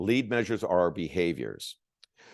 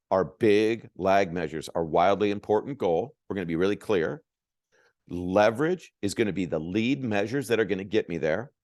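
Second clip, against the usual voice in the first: ordinary delivery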